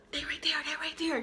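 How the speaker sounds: fast, unvoiced panicked whisper; urgent whisper. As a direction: hiss it so they can't hear. Hiding from the guys.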